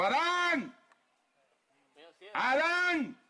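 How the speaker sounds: high, ringing call of a name; God calling Adam through the garden. Retold or imitated. (Dios llamando en voz alta)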